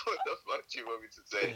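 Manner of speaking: normal talking voice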